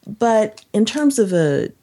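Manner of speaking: ordinary talk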